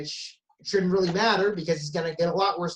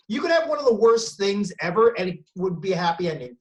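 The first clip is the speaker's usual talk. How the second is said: ordinary delivery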